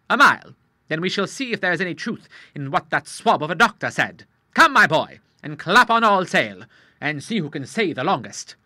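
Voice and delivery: put-on an old salt spoiling for a challenge; lightly voiced hearty old Admiral; old sea captain, eager.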